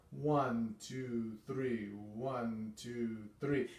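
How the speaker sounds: soft, legato beat count; soft sweet one two three. Shown as a demonstration. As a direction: count smoothly and gently. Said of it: "it's more sweet".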